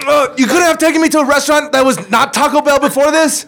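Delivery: indignant complaint. Pretend. Acted girl scolds boyfriend.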